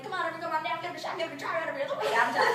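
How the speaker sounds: high, rattled-off microphone patter; carnival game barker. Retold or imitated. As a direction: call the crowd over like a game barker. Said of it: "I had the microphone, and I was like".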